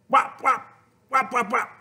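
punchy high whap sounds; whap sound effect. A narrator's reading. Reading to kids, clapping clay sound. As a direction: slap each whap out like clay in hands.

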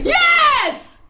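high, loud fierce agreement; fierce yes. Acted. Betty answering her inner voice, fierce shout. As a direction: fire back ready for battle.

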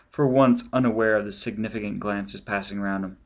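ordinary delivery, nothing marked

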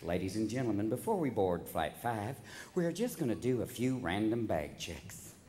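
Southern stewardess sweetly announcing random checks; lightly voiced Southern flight attendant. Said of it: "This sweet little Southern stewardess usually comes out and goes".

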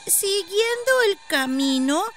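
put-on high, slow child's voice; a little kid haltingly giving directions; high-pitched, drawn-out child voice; village child. A narrator's reading.

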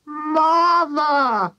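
high, long-drawn wail; high-pitched acted wail of dismay. A narrator's reading. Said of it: "wailed Missus Cranky".

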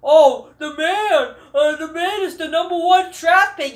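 mocking impression. Mocking fans' hype over pick.

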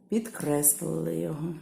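ordinary delivery

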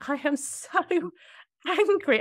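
high, laughing, half-mocking "I am so angry". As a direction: laugh through the words, pitch way up. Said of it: "I was like".

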